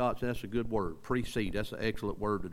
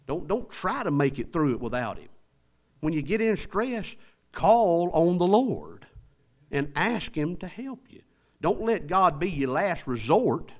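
high-pitched, urgent exhortation; forceful preaching; 6 semitones higher, 4 dB louder than usual. Real, not acted. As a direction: press each plea home to the congregation.

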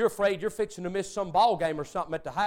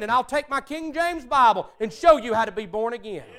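louder, higher, fervent altar-call plea; fervent preacher; 6 semitones higher, 6 dB louder than usual. Retold or imitated. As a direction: urge them down the aisle with fire. Preacher urging people forward.

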